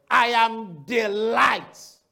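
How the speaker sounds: very slow, each word weighted; I am the light, spelled out word by word; quoting Jesus with solemn weight; slow emphasis. Real, not acted.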